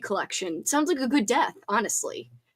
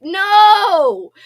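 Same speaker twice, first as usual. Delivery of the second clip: loud high "no!" of dismay; loud shocked groan of sympathy; dismayed cry; 8 semitones higher, 10 dB louder than usual. Real, not acted. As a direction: cry out in shock at bad news. Reacting to eaten tape.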